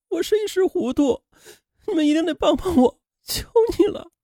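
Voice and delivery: desperate begging. A narrator's reading. Killer kneels before husband and father, high soft begging. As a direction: beg on your knees for help.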